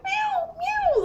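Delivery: very high-pitched mimicked meowing; panicking cat. Cat looking around from the windowsill, panicky meowing impression. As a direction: imitate an anxious cat's cries, tiny and high. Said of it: "She's, like, panicking".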